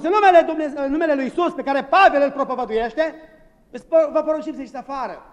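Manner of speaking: high, loud, pompous mock command; mock exorcists. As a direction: ham up the would-be exorcists ordering the demons out. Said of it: "au spus". (imitând exorciștii, poruncă pompoasă și stridentă)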